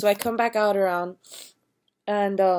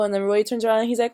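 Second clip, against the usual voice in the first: normal talking voice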